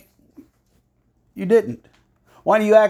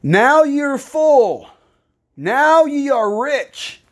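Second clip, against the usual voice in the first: high, drawn-out mock admiration; sarcastic; 9 semitones higher, much slower than usual. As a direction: stretch each phrase slowly with sarcasm. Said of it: "slipping into a little bit of sarcasm".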